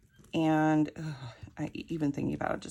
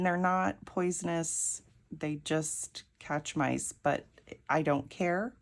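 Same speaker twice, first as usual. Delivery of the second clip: usual speaking voice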